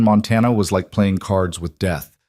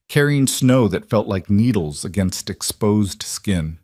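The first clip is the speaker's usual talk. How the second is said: usual speaking voice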